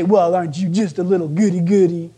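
acted sing-song sneer at a do-gooder; mocking taunt. Preacher imitates scoffers taunting a believer, mocking. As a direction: needle them as a scoffer would.

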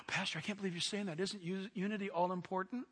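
quick, soft, shocked voice; shocked congregant. Acted. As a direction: play the scandalized listener.

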